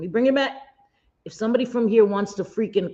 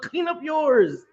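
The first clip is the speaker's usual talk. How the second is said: high, slow and drawn out, mocking; sassy comeback; 9 semitones higher, slower than usual. Telling the critics to clean up their own yard, high and slow and mocking. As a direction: stretch it high and slow, like a taunt. Firing back at online critics.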